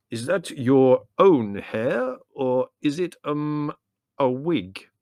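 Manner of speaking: loud, slow, teasing delivery; halting, relishing punchline. A narrator's reading. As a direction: draw out the joke loudly, savouring it. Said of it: "said Theodore with relish".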